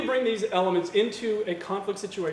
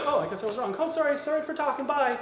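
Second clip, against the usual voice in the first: sheepish backdown; 6 semitones higher than usual. Acted. Sheepish backdown after being wrong.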